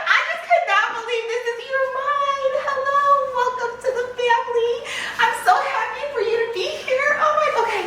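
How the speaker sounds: very high, laughing squeal; squealing delight. Real, not acted. Buyer welcoming new bag to the family, squealing. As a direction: squeal it, thrilled and far above normal pitch.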